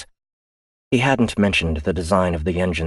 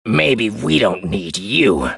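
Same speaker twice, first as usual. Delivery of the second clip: very slow, higher menacing echo; mocking echo; 3 semitones higher, 3 dB louder, much slower than usual. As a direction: throw his words back with menace. Otero throws it back.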